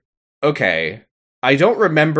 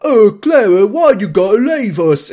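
high, loud pleading barkeep; tavern owner; 9 semitones higher, 6 dB louder than usual. Acted. Tavern owner begging his barmaid to stay, pleading put-on voice. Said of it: "the tavern owner is like".

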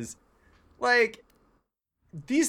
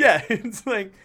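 laughing; 7 semitones higher, 7 dB louder than usual. Real, not acted.

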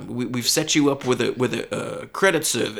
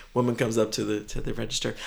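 usual speaking voice

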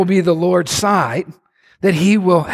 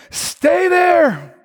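enemy warning; 11 semitones higher than usual. Acted.

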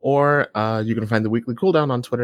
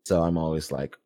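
ordinary talk

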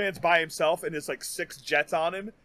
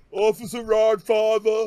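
loud, drawn-out character call; big mock catchphrase delivery; imitated show character; 5 semitones higher, 5 dB louder, slower than usual. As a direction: stretch it out high and loud. Quotes the show's best character.

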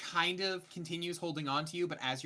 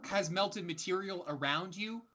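scene setting narration; 3 semitones higher, 7 dB louder than usual. Acted. GM scene setting over music.

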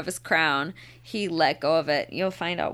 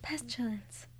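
breathy whispered name; 3 semitones higher, 12 dB quieter than usual. Retold or imitated. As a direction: whisper it hoarsely. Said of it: "That's how Sarah sounds?".

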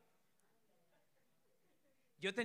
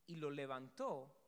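ordinary talk

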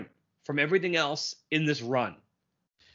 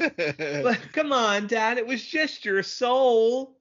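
put-on high, slow pleading girl; whiny kid voice; 8 semitones higher, much slower than usual. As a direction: whine it like a bratty kid. Voices Gosalyn whining to Dad.

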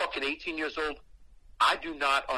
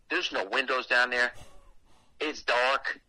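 ordinary talk, nothing marked

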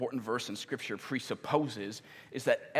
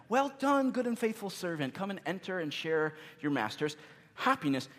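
high-pitched, lilting "well done" greeting; imagined divine welcome; 6 semitones higher than usual. Retold or imitated. Jesus welcoming us into heaven, imagined high-pitched greeting.

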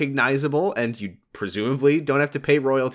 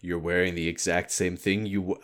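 quiet, low creepy whisper; creep voice; 4 semitones lower, 6 dB quieter than usual. Retold or imitated. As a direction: lean in like a creep. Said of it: "creep voice for Kyle".

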